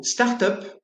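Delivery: "start-up" with the U as in "sœur"; French U in start-up. Shown as a demonstration. Teacher demonstrating the French U sound. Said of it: "comme dans le mot sœur".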